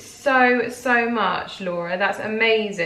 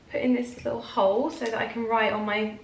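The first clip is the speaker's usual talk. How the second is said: ordinary talk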